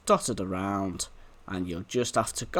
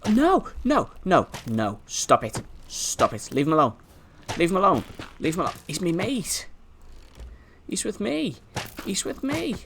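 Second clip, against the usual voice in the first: pleading repeats, climbing higher towards the end; urgent protest; 7 semitones higher than usual. Pretend. Player begging mobs to leave his companion alone, urgent protest. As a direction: call them off in mock panic.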